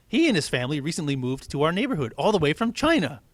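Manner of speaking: high, loud, bright teacher announcement; teacher introducing the new kid; cheery teacher. Acted. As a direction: welcome the class like a chipper teacher.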